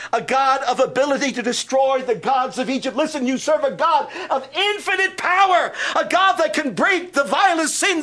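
high, loud, ringing pulpit proclamation; full-force preaching. Acted. Pastor to congregation, proclaiming God's power at full force.

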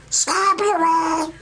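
very high mock squeal of the name; wrong calling voice. Shown as a demonstration. Preacher showing how God's call never sounds, very high mock squeal. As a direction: send the name up into a comic high pitch.

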